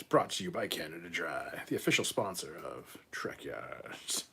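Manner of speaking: hushed fake sponsor tagline; whispered mock ad. Streamer jokes Canada Dry sponsors the show, whispered. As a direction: whisper it like an ad disclaimer.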